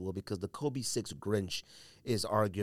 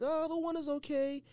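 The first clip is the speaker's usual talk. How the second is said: much higher, lightly mocking shrug of a voice; mock unimpressed. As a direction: play the lukewarm sneakerhead, pitched way up. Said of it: "it was like".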